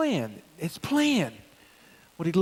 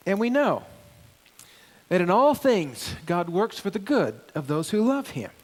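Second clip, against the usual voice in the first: raised, declaiming scripture reading; 2 semitones higher, 4 dB louder than usual. Acted. Pastor reading Romans aloud to the congregation, emphatic. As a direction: read Paul's words out to the church with weight.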